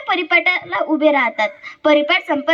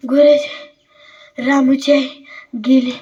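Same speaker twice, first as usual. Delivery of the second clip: halting, panting schoolchild; acted out of breath from running; breathless bad news; 4 semitones lower than usual. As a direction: break the news in short gasped pieces. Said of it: "काही मुलं पळत येतात".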